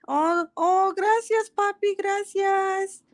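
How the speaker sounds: very high, drawn-out sing-song gratitude; gushing thanks. Pretend. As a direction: squeal your thanks sweetly, stretching it out. Gushing thanks after being handed something.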